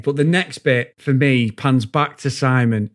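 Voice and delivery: ordinary talk, nothing marked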